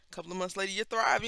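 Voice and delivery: ordinary delivery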